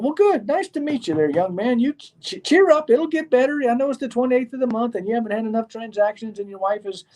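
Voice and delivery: high, patronising voice calming a struggling newcomer; condescending pity. Retold or imitated. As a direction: pat him on the head with pity. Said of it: "People won't".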